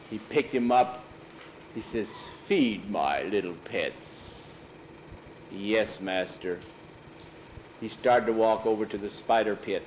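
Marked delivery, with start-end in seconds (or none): 2.5-4.7 low, very slow villain command; sinister master. Acted. Master ordering his servant to feed pets, sinister. 5.5-6.6 low, slow lumbering monster voice; obedient servant. Acted. Thor answering his master's order, obedient.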